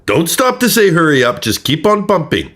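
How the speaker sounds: high, loud mock scolding; mock-angry dispatcher telling her to keep pumping; imitating angry dispatcher. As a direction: snap at her like the dispatcher, hammed up. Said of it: "he gets mad at her".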